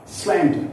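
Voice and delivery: "slender" with a high, strong SLEN; stress on first syllable. Shown as a demonstration. Teacher listing adjectives for learners, first-syllable stress. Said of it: "I stress on the first syllable".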